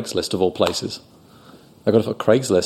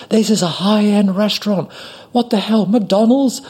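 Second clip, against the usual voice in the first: high-pitched outraged customer impression; mock angry yelling; 11 semitones higher than usual. Angry customer berating waiter at high-end restaurant, mock outrage. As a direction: scold in an indignant high voice. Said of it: "she sort of, you know, yelled".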